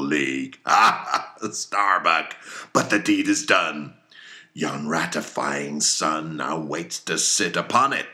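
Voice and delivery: put-on a fierce old sea captain rallying his crew; commanding, hammy captain with bursts of loud toasts; Captain Ahab voiced. Ahab to his mates and harpooneers, commanding and fierce. Ahab's oath against Moby Dick.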